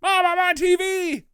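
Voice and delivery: put-on high, loud thrilled child voice; a zombie thrilled to be on the news; giddy kid. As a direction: squeal like a kid on TV.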